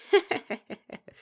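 high loud cackle of delight; gleeful laugh. Acted. The Kelpie laughing at Bon Bon, high glee. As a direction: burst out laughing.

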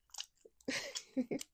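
very high-pitched teasing giggle. Acted. Laughing at the partner's wriggling, high giggle.

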